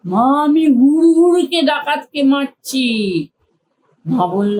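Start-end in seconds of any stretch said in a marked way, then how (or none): 0.1-2.9 put-on high, loud eager child; excited child shout. A narrator's reading. Piku tells mother he fights the bandit, excited shout. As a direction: shout it proudly mid-battle.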